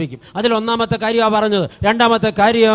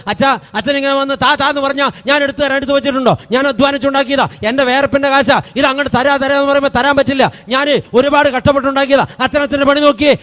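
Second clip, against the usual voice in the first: higher, louder grudging refusal; stingy parishioner; 7 semitones higher, 7 dB louder, faster than usual. Retold or imitated. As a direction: refuse the priest, clinging to the money. Stingy man refusing church collection.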